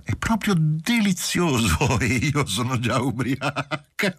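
high, giggling through the words; laughing tipsy. A narrator's reading. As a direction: laugh at her own tipsiness. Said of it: "Rise scuotendo la testa".

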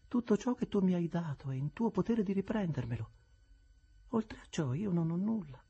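measured, unyielding calm; calm Estella. Acted. Estella answers her adoptive mother without raising her voice. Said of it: "senza mai alzare la voce".

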